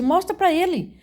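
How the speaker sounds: high, reenacted heavenly command; voz que brada. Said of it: "E a voz bradou". (brado agudo imitado, como ordem do céu)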